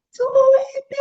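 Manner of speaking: very high, loud screaming cry; terrified scream. Retold or imitated. Mother screaming at her son to climb, terrified. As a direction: scream it in terror. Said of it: "le grité terroríficamente".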